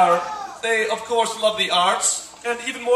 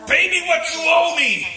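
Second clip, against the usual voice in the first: mock threatening demand; 6 semitones higher than usual. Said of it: "choked the other servant, saying".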